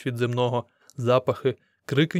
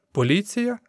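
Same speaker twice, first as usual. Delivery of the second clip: "Поліція" much higher, rising to a question; incredulous question; 8 semitones higher than usual. A narrator's reading. Crowd member echoes the newcomer's mention of police, incredulous. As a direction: echo "Поліція" back in disbelief. Mocking echo, crowd chuckles ironically.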